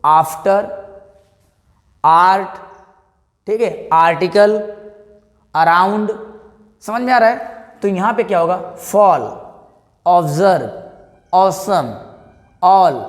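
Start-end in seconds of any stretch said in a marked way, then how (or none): 0.1-2.6 after and art as separate words with a long 'aa'; open 'aa' sound. Shown as a demonstration. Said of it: "आ बोला जा रहा है". 8.8-13.0 Fall, observe, awesome, all with rounded 'aw', short of a full 'o'; 'aw', close to 'o'. Shown as a demonstration. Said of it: "आपको ओ का sound आ रहा है".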